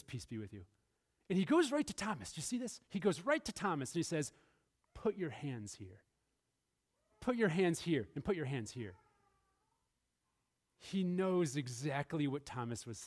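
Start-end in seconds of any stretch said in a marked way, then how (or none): 0.0-0.6 gentle greeting. Acted. Jesus greeting the disciples. 4.9-8.9 gentle invitation. Acted. Jesus inviting Thomas to touch.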